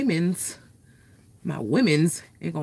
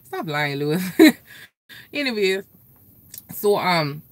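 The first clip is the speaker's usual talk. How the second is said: ordinary delivery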